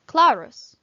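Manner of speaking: "clarus" with a short U in the last syllable; short U example. Shown as a demonstration. Teacher giving a short U example, "clarus" with brief final vowel. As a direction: keep the U in "clarus" brief, loud first syllable. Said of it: "Short U".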